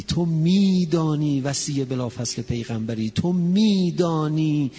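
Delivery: loud, high, impassioned sermon climax; preaching at full force. Acted. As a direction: cry it out to the mourners.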